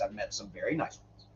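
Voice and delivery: usual speaking voice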